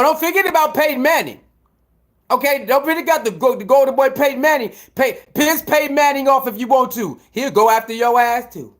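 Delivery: ordinary talk, nothing marked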